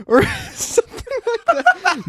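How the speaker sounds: giggling through the words; laughing. Real, not acted. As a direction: squeak it out between laughs.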